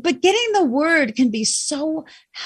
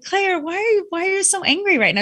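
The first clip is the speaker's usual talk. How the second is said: higher-pitched, puzzled parent's question; mother questioning daughter; 7 semitones higher than usual. Retold or imitated. Mother asks her daughter about being angry, puzzled. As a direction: ask your child what is wrong. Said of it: "talking to my daughter and I said".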